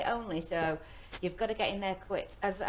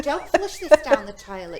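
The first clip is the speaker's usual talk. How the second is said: louder, imitating the toilet announcement; imitating the recorded toilet voice; train toilet voice; 3 semitones higher, 11 dB louder than usual. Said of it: "have a good chat with a voice".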